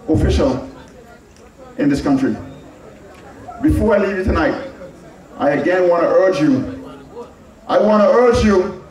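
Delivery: ordinary talk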